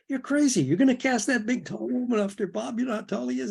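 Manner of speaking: high, exasperated retelling of a protest; incredulous outburst. Retold or imitated. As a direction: imitate a producer who can't believe it. Frank objects to casting.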